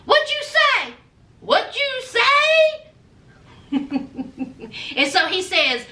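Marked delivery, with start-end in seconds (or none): 0.0-2.8 high, incredulous what-did-you-say; mock outraged disbelief, pitched up; shocked disbelief. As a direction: act out her jaw-dropping shock. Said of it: "Rosemarie was like".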